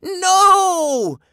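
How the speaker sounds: very high, loud, drawn-out scream. A narrator's reading. Kids as the robot dials emergency, screamed. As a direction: scream it in horror. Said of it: "the kids screamed".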